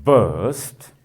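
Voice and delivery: "burst" drawn out with a firm, strong onset; loud stressed syllable. Shown as a demonstration. Teacher showing students a loud syllable, stressed demonstration. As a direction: hit "burst" as one stressed, stretched syllable. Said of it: "soft or loud?".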